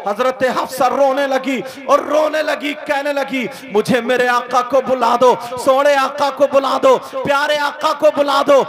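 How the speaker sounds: loud, passionate sermon delivery; fiery preaching. Acted. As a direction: preach it at full force to the crowd. Full-force preaching of the story. (जोशीली तक़रीर, पूरी ताक़त से)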